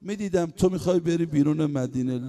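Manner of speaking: ordinary talk, nothing marked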